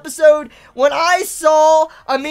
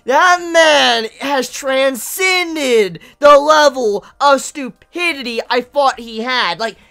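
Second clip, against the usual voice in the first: angry disbelief; 5 dB louder than usual. Real, not acted. Fan venting rage at a character's stupidity, loud. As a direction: spit out how dumb he is. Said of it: "I am just so mad".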